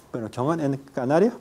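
ordinary talk

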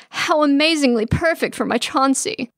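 high-pitched, gushing mother; excited gushing. A narrator's reading. Mama admires her son's bride, excited gushing. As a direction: gush excitedly, pitched well up. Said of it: "so excited she jiggles".

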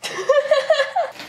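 high-pitched giggle; real, high-pitched laugh. Real, not acted. Laughing at the failed attempt.

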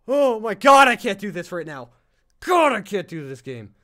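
loud frightened yelp; panicked, loud and high; scared outburst. Real, not acted. Horror game jump scare.

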